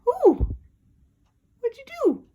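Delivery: high, loud startled ooh; surprised squeal. Real, not acted. Owner startled when the bird drops the toy, high squeal. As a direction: squeal at the sudden drop.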